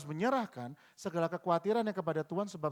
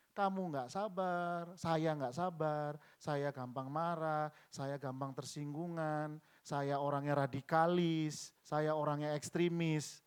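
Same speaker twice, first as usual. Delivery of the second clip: slow, measured listing of one's faults; slow confession; slower than usual. Retold or imitated. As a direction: draw out each admission deliberately. Slowly confessing faults before God.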